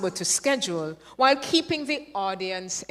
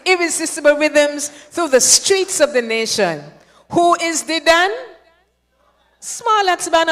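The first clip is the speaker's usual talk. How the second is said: loud, building emcee call-out, rising on the band name; hyped MC announcement; 4 semitones higher, 7 dB louder than usual. Acted. Emcee revving up the audience for the winners, loud and building. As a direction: announce the champions to the carnival crowd.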